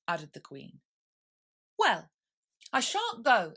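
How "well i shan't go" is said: high, loud stubborn refusal; defiant girl. Acted. Alice defiant.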